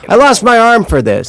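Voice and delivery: high, loud mock wail; mock dramatic lament. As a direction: play up the tragedy of losing an arm.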